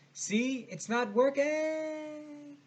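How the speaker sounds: high holler with the last word stretched out long; exasperated yell. Acted. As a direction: shout proof down to them, very drawn-out. Acted shouting down to hoisters.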